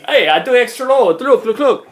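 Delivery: high, loud, badly faked Vietnamese accent; hammed-up Vietnamese accent, insistent; attempted Vietnamese accent. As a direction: imitate a pushy driver in a clumsy Vietnamese accent. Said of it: "I'm trying to do the accent".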